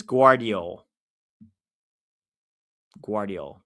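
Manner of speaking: Guardiol with a GW sound at the front. Shown as a demonstration. Streamer trying out Google's version, Guardiol with a W sound. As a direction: say Guardiol as GWAR-dee-ol. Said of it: "the V is pronounced W".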